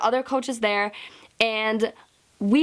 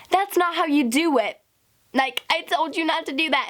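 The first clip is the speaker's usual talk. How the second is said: higher-pitched mock scolding; raised-pitch retold reprimand; scolding coach; 6 semitones higher than usual. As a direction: imitate an annoyed coach telling off an athlete.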